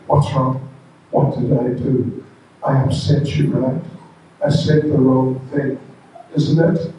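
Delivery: low, insistent questioning. Acted. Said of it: "He said".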